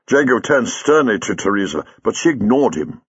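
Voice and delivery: plain reading voice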